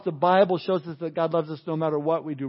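usual speaking voice

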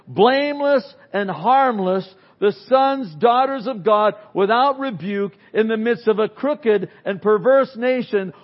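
preacher reciting Scripture with weight; scripture reading. Acted. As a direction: proclaim the verse to the congregation. Reads scripture aloud.

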